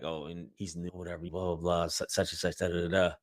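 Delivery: brushing the rookie off with a careless blah blah; mocking the DA. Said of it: "She's laughing in there like".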